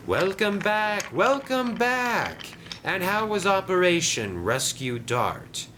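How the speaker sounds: high, drawn-out gleeful welcome; delighted Diesel. Acted. Diesel welcomes Den back, delighted. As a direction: greet a returning accomplice with high glee.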